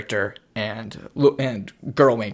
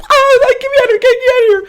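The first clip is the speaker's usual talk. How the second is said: mock panicked squeal; 12 dB louder than usual. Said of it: "He goes".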